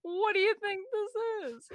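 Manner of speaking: high, slow, hammed-up indignation; mock outrage. Real, not acted. Friend pushed to start the stories, playing up mock outrage. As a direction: overplay it like you're scandalised. Repeats protest, exaggerated.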